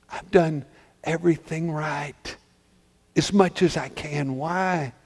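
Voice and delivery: soft, spent questioning of God; quiet despair. Acted. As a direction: drop to a hushed, wounded plea. Joseph's despair after screaming.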